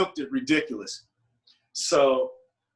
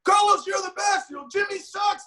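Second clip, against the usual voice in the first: high-pitched shout from the crowd; blurted heckle; 13 semitones higher than usual. Retold or imitated. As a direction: blurt it out like an overeager fan. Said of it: "he blurts out".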